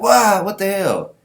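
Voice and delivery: high, loud burst of shock; mock astonishment. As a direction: exclaim it high, as an astonished onlooker. Character reacts to sword slash.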